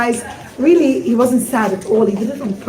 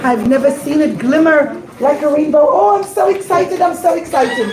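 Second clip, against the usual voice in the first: put-on an old sailor overjoyed; excited captain; 3 semitones higher than usual. Captain gushes over the rainbow diamond, thrilled. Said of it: "the captain was so excited".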